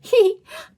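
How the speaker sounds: high, loud little giggle; short child's giggle. A narrator's reading. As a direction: giggle in a squeak. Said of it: "Peppa giggles".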